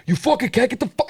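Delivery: high-pitched mock yell at a pet. Owner shooing the cat off the windowsill, high mock yell. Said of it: "I would yell at my cat".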